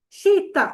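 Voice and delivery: شیتا said high and loud; emphasized new word. Shown as a demonstration. Teacher introducing new Arabic word to class, emphasized. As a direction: pop the word شیتا out sharply. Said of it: "شیتا کہتے ہیں".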